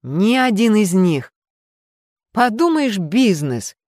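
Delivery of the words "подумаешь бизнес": high, dismissive scoff; scornful snort. A narrator's reading. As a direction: brush it off with a sniff. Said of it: "фыркнула мисс Уитерс".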